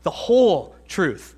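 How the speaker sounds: "whole" stretched slow and set apart from "truth"; stress on whole. Shown as a demonstration. Preacher showing the emphasis he loves, stressing "whole". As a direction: draw out "whole", then land "truth". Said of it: "I love that emphasis".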